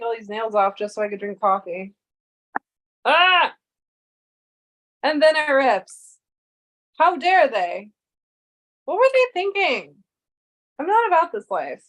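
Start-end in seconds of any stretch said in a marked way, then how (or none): 3.0-3.5 frustrated yell. Real, not acted. 5.0-11.8 louder indignant rant; frustrated ranting. Real, not acted. Streamer ranting after the bag rips, louder and indignant. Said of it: "I'm yelling at fucking coffee".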